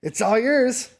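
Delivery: high, loud, pleased handover; satisfied dealer. Acted. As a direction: hand it over like a done deal. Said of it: "And it's like".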